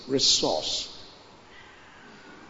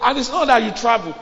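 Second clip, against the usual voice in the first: high, loud pulpit exhortation; fiery preaching; 6 semitones higher, 7 dB louder than usual. Acted. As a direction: drive the congregation with force. Preaching at full force.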